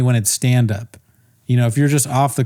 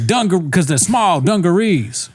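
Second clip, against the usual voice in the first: high-pitched parody joke delivery; mock host punchline; 6 semitones higher than usual. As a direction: explain the bad joke with put-on swagger. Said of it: "and he's like".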